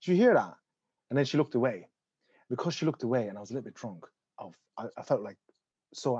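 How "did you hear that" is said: louder, higher indignant challenge; confronting the police officer; indignant demand. Retold or imitated. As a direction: call her out, outraged. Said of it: "I go".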